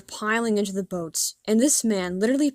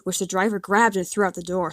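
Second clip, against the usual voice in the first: usual speaking voice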